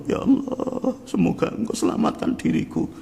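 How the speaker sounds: high, breathless whispered plea; acted half-whispered supplication; moved prayer. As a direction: pray under the breath in a high, pleading voice. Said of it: "yang bilang begitu".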